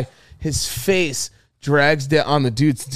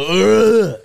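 loud, higher roaring grunt; brute grunt; 7 semitones higher, 7 dB louder than usual. Shown as a demonstration. Pitching a wordless movie bruiser, loud brute grunt. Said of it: "Just make him go".

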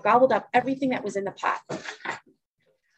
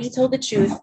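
ordinary delivery, nothing marked

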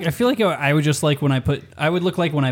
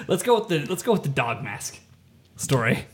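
usual speaking voice

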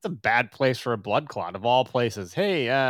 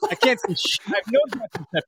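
mock Teddy Roosevelt; 8 semitones higher than usual. Playing blind Roosevelt.

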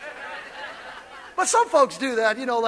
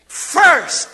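louder first for the congregation; loud repeated call; 6 dB louder than usual. Shown as a demonstration. Pastor drills the congregation, loud. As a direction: drive the word home. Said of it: "Say it again".

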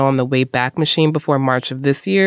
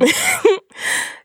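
high laugh. Real, not acted. Co-host laughs before clip intro.

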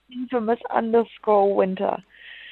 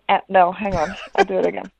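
ordinary talk, nothing marked

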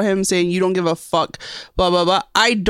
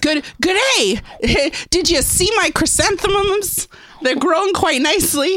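high, prim, hammed-up suburban lady voice; 10 semitones higher than usual. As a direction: play a prissy garden-proud neighbour, pitched way up.

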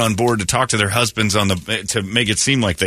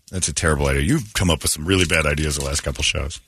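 normal talking voice

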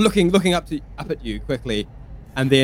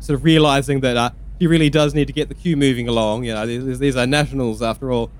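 normal talking voice

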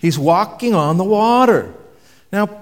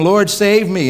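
a bit louder, pleading cry; 5 semitones higher, 5 dB louder, slower than usual. A narrator's reading. Peter sinking in the waves, crying out for rescue. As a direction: call out to be saved. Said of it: "cries out".

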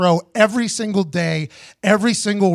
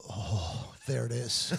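much softer, low and murmured aha; hushed realization; 9 semitones lower, 14 dB quieter, much slower than usual. Retold or imitated. Overhearing the secret behind them, a hushed murmured aha. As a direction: breathe it out under your breath.

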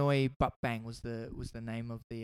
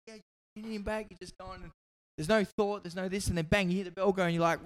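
ordinary delivery

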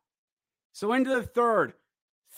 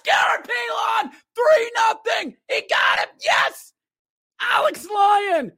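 shouting high and fast at the goal; screaming play-by-play joy; excited goal call; 8 semitones higher, 3 dB louder than usual. Real, not acted. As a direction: yell the goal like a rabid fan. Excited goal call, fan watching.

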